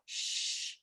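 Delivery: a long voiceless "Shh" that keeps going; held shush. Shown as a demonstration. Teacher showing the class that sh can be held, soft voiceless shush. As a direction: hush the room with one held breath. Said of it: "you can go".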